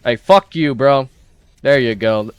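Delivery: loud taunting yell; trash talk shout. Real, not acted. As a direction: shout it at the enemy. Trash talk after killing enemy.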